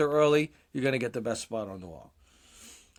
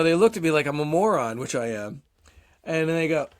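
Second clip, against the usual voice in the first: usual speaking voice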